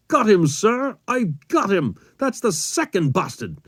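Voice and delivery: gunner's high, triumphant yell; excited yelling. A narrator's reading. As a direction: yell it up to the commander over the din. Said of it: "I heard my gunner yelling".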